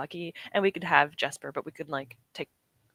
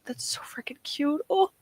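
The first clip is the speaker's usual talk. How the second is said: cooing whisper; 8 semitones higher, slower than usual. Real, not acted. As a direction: whisper it like cooing over a puppy. Cooing over a cute picture.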